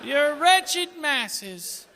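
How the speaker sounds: put-on slow, lofty proclamation as young America; grand declaiming child.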